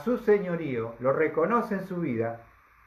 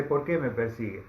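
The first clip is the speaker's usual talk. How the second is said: voice from heaven; 7 semitones lower than usual. Acted. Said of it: "escucha esa voz en hebreo que le habla al corazón".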